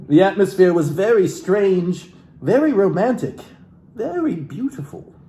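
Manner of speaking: lightly theatrical book reading; a hint of stage reading from the book; dramatic reading. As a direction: read the passage like a soulful memoir. Continues reading the book aloud.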